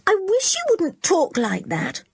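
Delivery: put-on high, unhappy girl's voice; unhappy Anne. A narrator's reading. Anne begs the boys to drop adventure talk, unhappy. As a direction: plead with him to stop.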